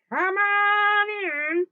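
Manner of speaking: loud, high, long-drawn invitation; wolf posing as Grandma. Acted. Wolf calls Little Red inside, high and loud. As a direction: stretch every word in a fake granny voice.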